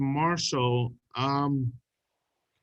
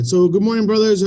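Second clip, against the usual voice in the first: bright, much louder hello to the brothers; loud greeting; 7 semitones higher, 9 dB louder than usual. Real, not acted. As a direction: call out good morning to the room.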